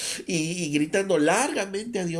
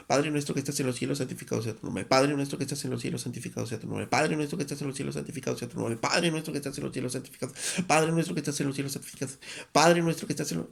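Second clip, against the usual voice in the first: fast, rattled-off prayer, looping mindlessly; mechanical rushed repetition; much faster than usual. Shown as a demonstration. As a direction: gabble the Lord's Prayer on autopilot. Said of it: "Imagínense algo así".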